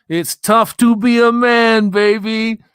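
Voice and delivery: high, loud and slow put-on quote; movie quote voice. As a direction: ham up a famous movie line. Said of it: "Tell me what movie that was from".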